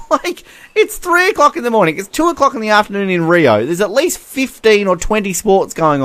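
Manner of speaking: high, exasperated disbelief after a laugh; baffled, high-pitched protest; incredulous rant. Real, not acted. Incredulous rant about the TV coverage.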